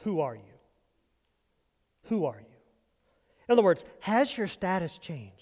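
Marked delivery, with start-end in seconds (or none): 0.0-2.6 hushed wondering question. Retold or imitated. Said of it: "what she's saying is".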